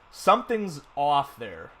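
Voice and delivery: ordinary delivery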